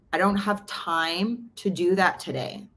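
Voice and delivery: slow, drawn-out brush-off; slow retold excuse. To a friend, slowly stating the excuse. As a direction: let each word of the excuse drag. Retelling her own first response.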